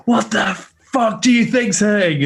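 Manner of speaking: high, loud incredulous shout at the medics; exasperated yell. Retold or imitated. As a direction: yell it in disbelief at useless helpers. Retelling yelling at medics.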